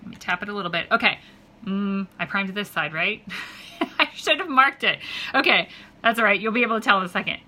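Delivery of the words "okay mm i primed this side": ordinary delivery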